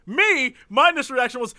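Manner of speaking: ordinary delivery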